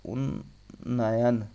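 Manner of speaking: उन्नयन very slow, split as un... na-yan; double n shown. Shown as a demonstration. Teacher showing students the two ना, slowly split उन्नयन. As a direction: hold the first n of उन्नयन, then na-yan. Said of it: "दो ना होता है".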